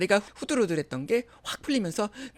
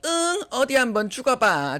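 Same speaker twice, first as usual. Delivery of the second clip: higher, mocking dare; callous goading; 6 semitones higher, 4 dB louder than usual. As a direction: goad them with cruel nonchalance. Sister-in-law goads her. (비웃듯 부추기는 말투)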